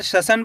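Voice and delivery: शसन as "sha-san", both vowels short; short first vowel. Shown as a demonstration. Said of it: "ऐसे नहीं पढ़ना आपको". (शसन, बिना मात्रा खींचे)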